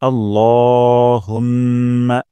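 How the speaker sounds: আল্লাহুম্মা very slow, the ল stretched one alif; slow Quranic recitation. Shown as a demonstration. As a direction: draw out আল্লাহুম্মা, holding the ল. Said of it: "এখানে ল একালির টান দিব". (ল এক আলিফ টেনে আল্লাহুম্মা)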